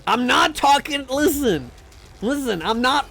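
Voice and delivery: indignant outburst; exasperated protest. Real, not acted. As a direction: push back at chat, voice up. Protesting to chat.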